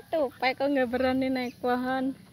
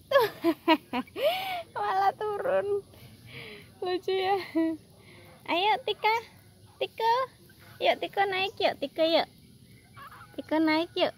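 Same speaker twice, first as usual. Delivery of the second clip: higher sing-song coaxing with rising yuk; coaxing pet talk; 8 semitones higher than usual. Acted. Urging her squirrels to climb the tree, higher sing-song coax. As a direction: coax them up like a fond owner.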